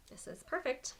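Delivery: muffled behind a face covering; through a balaclava. Real, not acted. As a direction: talk through a scarf over the mouth. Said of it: "If that was really muffled".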